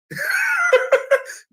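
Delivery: a high, disbelieving laugh; incredulous high laugh. Real, not acted. After denying the accusation, a disbelieving laugh. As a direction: laugh out in exasperation.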